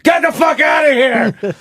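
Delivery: hurt father yelling at his son, mock shout; crying dad snaps. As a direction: bark him out of the room through tears, hammed up. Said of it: "He goes".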